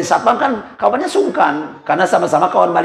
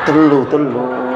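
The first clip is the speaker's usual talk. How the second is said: low slow three; 7 semitones lower, much slower than usual. Retold or imitated.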